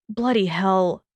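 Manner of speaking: ordinary delivery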